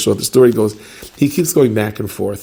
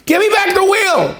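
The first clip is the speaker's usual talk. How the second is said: desperate shout. Retold or imitated. Stranded driver shouting at the dead father-in-law, high strained yell. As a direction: yell after the man who took your wheel.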